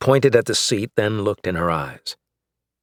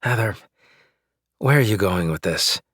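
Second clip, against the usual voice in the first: plain reading voice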